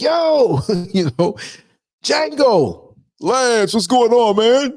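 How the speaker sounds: high, loud excited greeting voice; imitating his friend. Bus friend greeting him on the street, high and loud. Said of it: "that's the way he talks".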